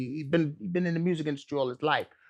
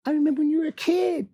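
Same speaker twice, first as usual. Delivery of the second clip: light high offer; 13 semitones higher, faster than usual. Retold or imitated. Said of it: "he's like".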